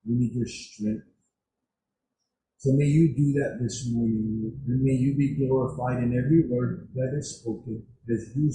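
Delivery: low-pitched, earnest prayer with pauses. Real, not acted. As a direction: address God reverently, pausing between petitions. Leads the congregation in prayer.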